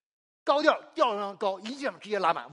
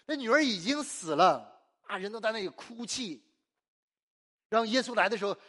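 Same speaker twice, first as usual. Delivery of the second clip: ordinary delivery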